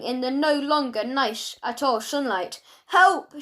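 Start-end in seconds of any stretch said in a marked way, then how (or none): 2.9-3.4 high, loud cry for help; shout for help. A narrator's reading. Malcolm dangling upside down before the knives, shouting. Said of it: "shouted Malcolm".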